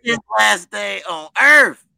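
slow, loud, menacing declaration; loud threat. Real, not acted. Friend warning about the spitter, loud and slow. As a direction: lean in and threaten slowly.

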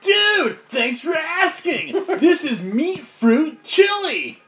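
high, loud stoked dude-bro; Fugamon. Acted. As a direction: stretch it out high, a bro boasting. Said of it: "Second voice".

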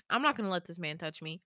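usual speaking voice

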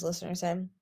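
ordinary delivery

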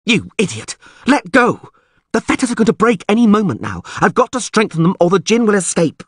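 high, urgent furious voice; frantic angry shouting. Acted. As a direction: shout it, desperate and enraged. Struggling, urgent angry pleading.

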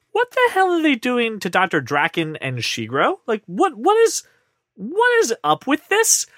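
rising disbelief, slowing at the end; incredulous fan. Retold or imitated. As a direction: voice a baffled fan. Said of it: "the question that I got from our fan".

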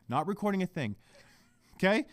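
usual speaking voice

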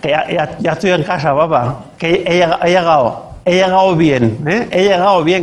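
put-on slurred drunk son mumbling to dad; mock drunk voice at dawn; drunk teen. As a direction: play it sloppy and tipsy, lightly done. Drunk teen speaking to his father.

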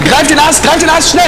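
high, loud rescue command; urgent shout. Acted. Man on the bank to his friend in the water, urgent shout. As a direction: shout it fast and desperate.

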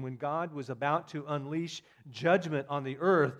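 ordinary talk

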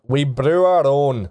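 gruff dwarf, lightly voiced; a touch of stubborn dwarf pride. Dwarf turning back to the party, lightly voiced dwarf. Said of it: "He just turns and says".

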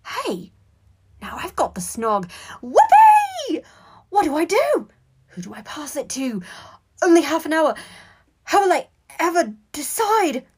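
high whoop, then frantic questions; elation turning to panic. A narrator's reading. Boy realising he must now pass it on, excited panic. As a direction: shriek with glee, then fret loudly.

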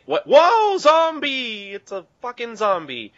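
squealing high alarm at a zombie; mock zombie shock. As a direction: shriek it like you just spotted a zombie. Mock fright at zombie scene.

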